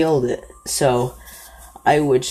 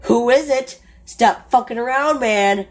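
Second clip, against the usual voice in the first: high retold yell through the door; yelling outside; 7 semitones higher than usual. Scared friends yelling through the cabin door, high retold yell. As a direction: call out high and sharp at the unseen visitor. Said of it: "We keep yelling outside".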